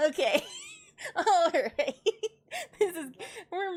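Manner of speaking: high, loud words through laughter; helpless real laughter breaking into the words; laughing hard. Real, not acted. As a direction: crack up while trying to speak. Cracking up at the retort.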